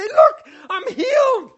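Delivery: very high, loud, tearful joy breaking into laughter; ecstatic healed man. Pretend. Healed man hugging Jesus, crying out with joy. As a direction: shout it out, overwhelmed with gratitude.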